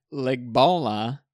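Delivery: Legbola said slowly, syllable by syllable; sounding out words. Shown as a demonstration. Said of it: "slow careful reading".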